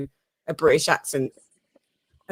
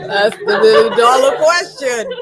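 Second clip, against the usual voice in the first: higher, louder, shouted through laughter; excited exclamation; 5 semitones higher, 7 dB louder than usual. Real, not acted. Host hailing the million dollar question, excited shout. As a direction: call it out loudly over the laughing.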